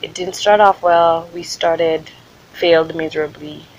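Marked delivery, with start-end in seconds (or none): none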